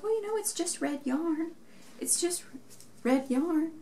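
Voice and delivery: high sing-song telling herself it's nothing; self-reassurance. Real, not acted. Vlogger reassuring herself about the yarn, high and sing-song. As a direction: talk yourself down in a squeaky high voice. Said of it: "I keep telling myself".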